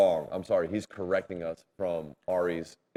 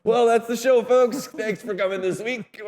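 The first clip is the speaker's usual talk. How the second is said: much higher, louder cheesy host voice; show host sign-off; 7 dB louder than usual. Acted. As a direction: ham it up like a TV host. Mock signing off the show.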